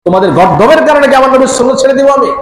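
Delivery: high, fast, indignant challenge; acting out an outraged retort; retold defiant shout. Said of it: "চিৎকার দিয়ে বলেছেন".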